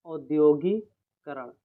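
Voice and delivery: औद्योगिकरण said slowly and firmly, syllable by syllable; teacher stressing the correct spelling slowly; slow emphatic demo. Shown as a demonstration. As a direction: stretch out औद्योगिकरण for the class. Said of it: "औद्योगिकरण होता है".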